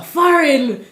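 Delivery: high-pitched Patois exclamation; Jamaican Patois. Retold or imitated. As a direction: imitate a punchy Patois reply. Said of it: "translating it into the Patois dialect".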